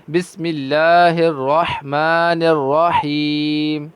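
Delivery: slow, drawn-out melodic tajweed chant; Quran recitation. Shown as a demonstration. Teacher reciting Quran for students. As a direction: recite each verse long and measured.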